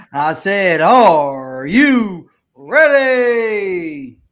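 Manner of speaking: huge, slow stretched shout; grand entrance announcement. Acted. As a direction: roar it out to the arena. Repeats call to crowd, shouted.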